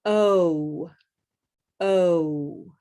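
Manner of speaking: "Oh" gliding with the lips closing; moving O sound. Shown as a demonstration. Teacher demonstrating the O glide to the class. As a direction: close the lips as "Oh" moves. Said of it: "We move our lips".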